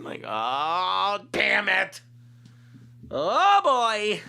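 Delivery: loud, high groan of dread; dreading dismay. Acted. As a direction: cry out as the bad news lands. Reacts to hearing the friend's name.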